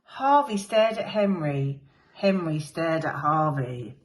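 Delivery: ordinary delivery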